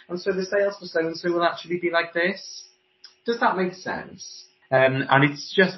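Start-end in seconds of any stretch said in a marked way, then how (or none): 0.1-4.5 higher-pitched, phrase "like this" lifting into a question; questioning upspeak. Retold or imitated. Voice coach mimicking sales talk, phrases turning into questions. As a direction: tail off upward as if asking. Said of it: "the end of their phrases turns into a question".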